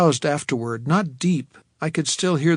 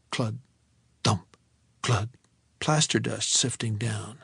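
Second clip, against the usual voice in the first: ordinary delivery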